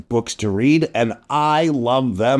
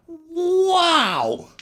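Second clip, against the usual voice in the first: amazed wow; 13 semitones higher than usual. Acted. Amazed at cat jumping fact.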